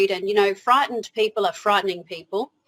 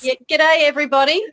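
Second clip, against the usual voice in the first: exaggerated Australian accent, higher and louder; hammed-up Aussie greeting; 6 semitones higher, 6 dB louder, faster than usual. Australian guest greeting the listeners in a playful Australian accent. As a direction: play up the Australian accent for the audience. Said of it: "you are in Australia with this accent".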